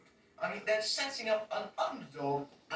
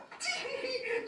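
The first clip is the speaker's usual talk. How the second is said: high shrieking laugh. Real, not acted. Laughing at the scene.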